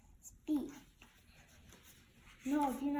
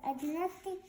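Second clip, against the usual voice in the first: plain reading voice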